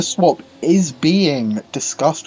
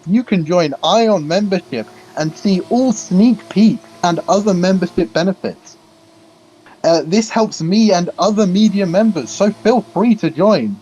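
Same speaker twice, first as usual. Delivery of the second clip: higher, formal read-out promo pitch; membership speech; 4 semitones higher than usual. Acted. Commentator reading a membership plug to chat, formal promo voice. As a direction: deliver the prepared sales speech with ceremony. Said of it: "I've got a speech for the membership thing".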